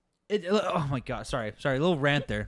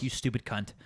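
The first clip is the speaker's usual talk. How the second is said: ordinary talk, nothing marked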